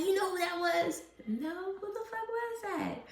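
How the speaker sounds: squeaky mock back-and-forth; mock exchange about a celebrity. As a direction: act out both sides of the gossip, high and cartoonish. Famous people pointed out, retold.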